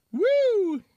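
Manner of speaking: high loud whoop; excited whoop. Real, not acted. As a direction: yelp it out high. Sauce drips, reacts with alarm.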